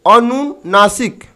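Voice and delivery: अनुनासिक drawn out slowly, each syllable clear; teacher dictating अनुनासिक at half speed; slow sounding out. Shown as a demonstration. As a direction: say अनुनासिक slowly for students to copy. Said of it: "दूसरा number में हो जाता है".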